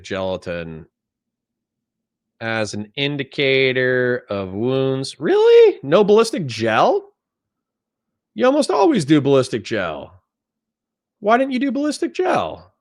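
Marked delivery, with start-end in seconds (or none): 5.2-12.7 high-pitched, questioning disbelief; incredulous. Real, not acted. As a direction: voice shoots up high in baffled protest. Can't believe video lacks gel test.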